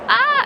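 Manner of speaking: a loud, high squeal of delight; excited squeal. Real, not acted. Vlogger squealing with delight over her Gales coffee. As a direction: squeal with joy at the treat.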